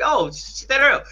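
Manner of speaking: high, slow imitated exclamation; delighted recognition. Fan voices idol knowing his friend, high and delighted. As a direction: draw it out high as if suddenly recognizing someone.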